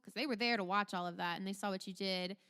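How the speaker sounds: ordinary talk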